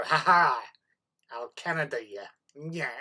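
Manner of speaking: hammed-up Canadian accent, loud laugh; goofy Canadian accent. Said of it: "I'll start talking in Canadian".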